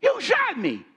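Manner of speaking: a wounded man crying out in shock; high strained cry of a shot friend; shocked disbelief. Retold or imitated. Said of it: "he looks at me and goes".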